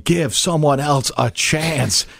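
slow, drawn-out mock plea; exasperated crowd. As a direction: stretch each word like a fed-up fan. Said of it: "everybody else in the world ... like".

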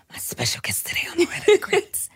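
hushed whisper retake; whispered redo. Shown as a demonstration. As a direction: whisper the intro again. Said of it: "I felt like I just had a tongue twister".

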